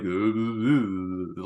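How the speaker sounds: imitating a mumbling actor with drawn-out grunts; mock his voice in long wordless drones; exaggerated dopey "uh" impersonation; Sly Stallone. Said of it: "like his voice and all that".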